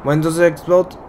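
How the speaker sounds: ordinary talk, nothing marked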